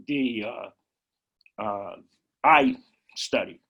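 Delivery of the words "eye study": speaking through a throat tickle; words catching in the throat, needing to clear it. Real, not acted. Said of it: "I'm choking here a little bit".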